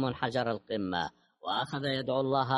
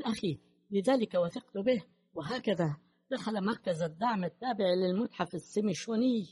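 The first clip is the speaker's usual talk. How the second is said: higher-pitched, agitated woman's voice; nervous agitation; 6 semitones higher than usual. A narrator's reading. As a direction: tell it on edge, voice climbing. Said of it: "قالت بعصبية". (نبرة عصبية منفعلة)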